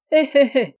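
high, loud "hé hé" laugh; acted laughing. A narrator's reading. Yann mocks the man he hit, high acted laughter. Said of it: "Yann riait à se tordre".

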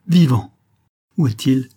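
high, loud burst of astonished relief; excited cry. A narrator's reading. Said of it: "s'écria-t-elle".

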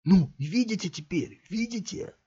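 high, eager, then dropping to a hiss; gleeful thug. A narrator's reading. Thug in cap holds the match, showing friends. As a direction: show it off triumphantly.

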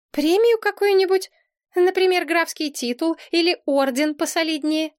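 high, questioning suggestions; puzzled princess. A narrator's reading. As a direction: float ideas in a high, unsure voice. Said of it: "недоумевала принцесса". (недоумённо, высоким голосом)

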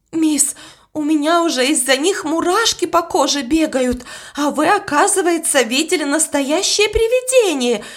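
scared maid rattling on; frightened. A narrator's reading. Young maid tells Miss she has goosebumps, mildly scared. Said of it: "плакала и дрожала".

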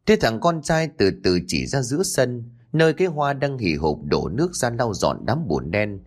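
plain reading voice

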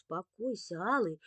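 usual speaking voice

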